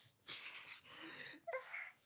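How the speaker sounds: high, wheezing mock sobs; put-on weeping in breathy high bursts; acted crying. She collapses after hearing about Steven's mom, acted crying. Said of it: "she starts crying again".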